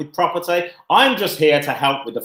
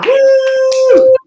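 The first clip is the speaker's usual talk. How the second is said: loud high whoop; excited cheer; 9 dB louder than usual. Real, not acted. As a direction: cheer the guest in. Cheering the guest's arrival.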